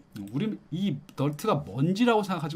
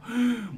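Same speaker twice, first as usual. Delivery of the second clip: sharp high gasp, puffing up the chest; 8 semitones higher than usual. Shown as a demonstration. Teacher shows students a puff-up gasp. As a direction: suck in air like a chest-thumping gorilla. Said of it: "puff up이니까 어떤 느낌이야".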